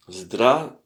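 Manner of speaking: usual speaking voice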